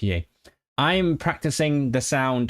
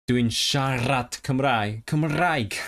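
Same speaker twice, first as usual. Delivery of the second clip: slow Welsh with a rolled r in "siarad" and "Cymraeg"; Welsh trilled r; much slower than usual. Shown as a demonstration. Learner demos traditional Welsh, rolling each r in "Cymraeg". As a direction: say the Welsh slowly, trilling every r. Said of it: "you use the trill all the time".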